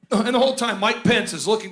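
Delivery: usual speaking voice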